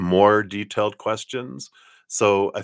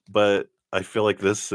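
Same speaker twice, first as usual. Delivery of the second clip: usual speaking voice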